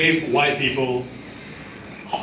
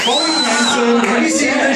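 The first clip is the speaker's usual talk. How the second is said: shouted at the crowd; 7 semitones higher than usual. Real, not acted. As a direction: call it out at the whole room.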